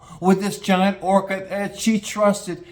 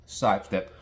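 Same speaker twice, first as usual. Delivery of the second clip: ordinary talk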